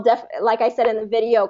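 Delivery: usual speaking voice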